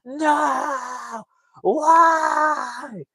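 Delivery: very high, long drawn-out wail; hammed-up cry of despair; mock yell into canyon. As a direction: howl each word out long, as if into a canyon. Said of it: "yell into the canyon".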